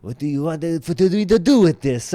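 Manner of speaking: a hint of a Quebecois French accent; lightly put-on Quebecois accent. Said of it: "my best, uh, Quebecois impersonation".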